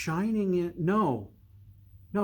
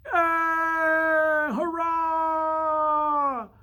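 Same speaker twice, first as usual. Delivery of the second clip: much higher, louder cheer; cheering clamor; 13 semitones higher, 4 dB louder, much slower than usual. Acted. Workers cheering the boy's arrival. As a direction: cheer like a far-off crowd. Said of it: "A clamor".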